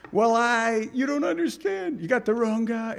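high, flustered self-defense; flustered protest. Retold or imitated. Voicing ourselves protesting to accusers.

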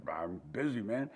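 low, soft, offhand I'm-busy voice; mock busy brush-off. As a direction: toss it off like someone too busy to care. Imitating a busy dismissive listener.

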